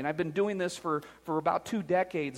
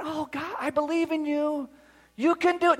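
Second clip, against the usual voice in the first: higher, soft mock pep talk; mock the gentle cheerleader; mock soft encouragement; 9 semitones higher than usual. Shown as a demonstration. Pastor mocking how Marines don't talk, in a mild soft voice. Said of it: "It's not".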